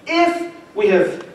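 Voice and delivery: if drawn out high and slow; a slow, lifted if before the pause; stress on if. Shown as a demonstration. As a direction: hang on the word if. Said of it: "I don't want to miss that if".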